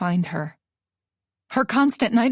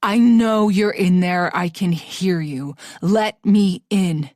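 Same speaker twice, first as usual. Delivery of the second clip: put-on menacing drunk man; 2 semitones lower, slower than usual. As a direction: lean on each word through the door. Nick menacing at door.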